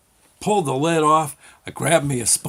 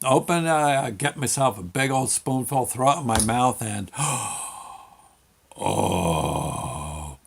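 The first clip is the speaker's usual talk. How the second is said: ordinary delivery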